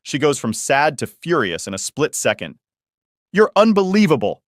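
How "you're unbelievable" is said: retold yell, much higher and louder; mock yell. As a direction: belt it out like a furious sister. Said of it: "she yells".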